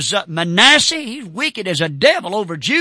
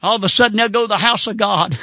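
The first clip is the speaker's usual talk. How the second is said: normal talking voice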